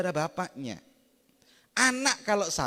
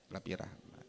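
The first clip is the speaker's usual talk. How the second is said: quiet echo; 8 semitones lower, 18 dB quieter than usual. Real, not acted. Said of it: "Karena lebih rahmat".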